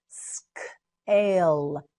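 "Scale" split into s, k, long ale; slow sounding-out. Shown as a demonstration. Teacher models word in parts.

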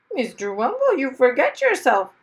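parrot voice. Acted. Parrot behind him.

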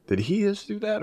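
high, disbelieving question; surprised. Retold or imitated. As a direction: sound stunned by what he did. Shocked at coworker's joke.

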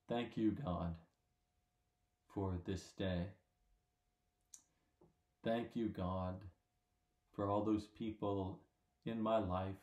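measured, subdued prayer, leaving space after each phrase; slow prayer. Real, not acted. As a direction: say it slowly, phrase by phrase, for others to follow. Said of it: "and I'll say it slow".

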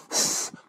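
sharp puff of breath; imitated blowing. Preacher trying to make him fall out, blowing. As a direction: blow hard like a preacher at the altar. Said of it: "You can blow all you want".

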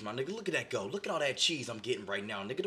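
ordinary talk

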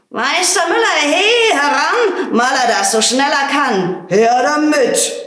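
high, loud, eager shout; calling out. Acted. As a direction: hail the miller from afar, gleeful. Farmer calls out to the miller.

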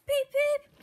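loud, very high train-whistle call; cried out. A narrator's reading. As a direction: pipe it out like a warning whistle. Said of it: "cried Edward".

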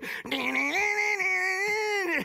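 high wailing electric guitar noise; mimicked guitar solo. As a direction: squeal like a lead guitar. Mimics [name] playing guitar solo.

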